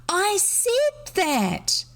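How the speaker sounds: high, slow and stretched; small girl protesting, very high; shrill insistence. A narrator's reading. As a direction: draw it out high like an offended child.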